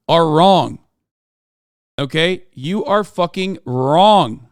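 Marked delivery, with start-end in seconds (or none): none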